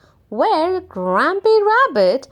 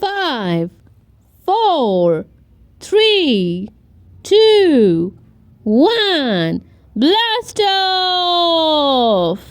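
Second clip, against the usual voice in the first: rocket countdown; 4 dB louder, much slower than usual. A narrator's reading.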